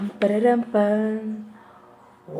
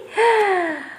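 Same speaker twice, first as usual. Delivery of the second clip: a big pretend gasp of wonder; hammed-up classroom gasp; mock surprised gasp; 7 semitones higher than usual. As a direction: gasp as if amazed by the card. Reveal of cow card.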